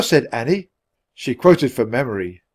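ordinary delivery, nothing marked

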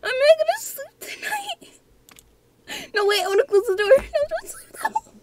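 real high-pitched panic over sleeping; panicked squeal. Real, not acted. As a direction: shriek in panic. Panicking at game threat.

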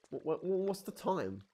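ordinary talk, nothing marked

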